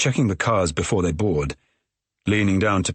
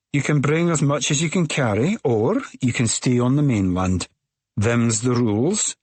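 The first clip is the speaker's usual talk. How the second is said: stern Scottish ferry woman; 5 semitones higher than usual. A narrator's reading. Ferry woman lays down rules.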